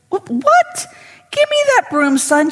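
high, loud mock outrage; retelling Haley scolding the janitor, high and loud; indignant older woman. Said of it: "She goes".

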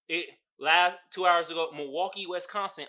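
ordinary talk, nothing marked

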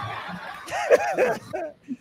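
high-pitched burst of laughter; laughing. Real, not acted. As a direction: crack up at the joke. Laughing at the accent bit.

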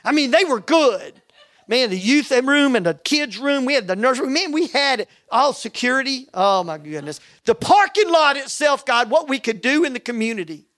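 worked-up, insistent complaint in prayer; frustrated with God. Retold or imitated. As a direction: argue your case to God in exasperation. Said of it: "just frustrated with God".

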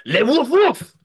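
mock French woof. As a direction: bark it like a French dog. Mock French dog bark.

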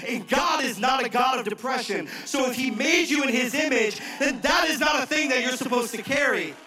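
loud, high, driving preacher's cry; preaching at full force. Acted. Pastor rallying his congregation, preaching at full force. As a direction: hammer the point home to the crowd.

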